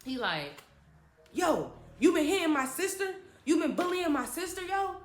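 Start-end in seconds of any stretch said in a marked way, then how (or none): 1.3-5.0 confrontational brother. Retold or imitated. Acted brother confronting the bullies.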